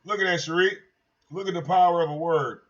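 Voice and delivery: ordinary delivery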